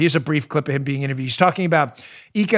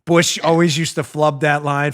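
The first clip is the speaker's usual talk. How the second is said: ordinary delivery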